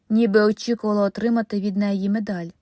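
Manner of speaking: usual speaking voice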